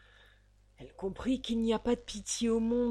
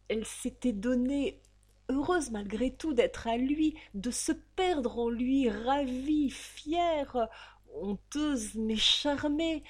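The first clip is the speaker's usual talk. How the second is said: rapturous; 6 semitones higher, 4 dB louder, slower than usual. A narrator's reading. Rapturous memory of love.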